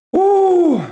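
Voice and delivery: high, loud whoop of joy; excited whoop. Real, not acted. Streamer cheering the stock's surge, whooping. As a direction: let out a celebratory whoop.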